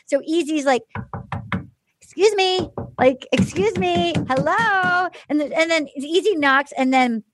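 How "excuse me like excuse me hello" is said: mock polite knock. Easy knocking on door.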